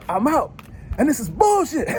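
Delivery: high mock outburst, quitting in a huff; defiant quitter. Querent storming off from the fight, high mock huff. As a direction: toss it off like storming out.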